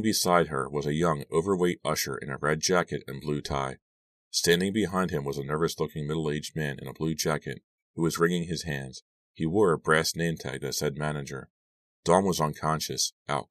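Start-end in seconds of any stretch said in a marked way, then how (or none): none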